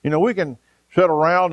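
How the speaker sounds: usual speaking voice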